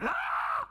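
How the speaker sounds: sudden high yelp of fright; short hammed-up shriek; mock scream of fear. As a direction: cry out like a startled sailor. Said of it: "which I imagine sounded a bit like".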